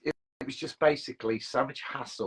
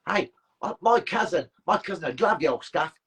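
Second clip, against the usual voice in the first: a rough, hammed-up Australian accent, pitched up; shitty Australian accent; 7 semitones higher, 6 dB louder than usual. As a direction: toss off a bad Australian accent for a laugh. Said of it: "That's my shitty Australian accent".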